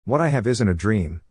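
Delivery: ordinary delivery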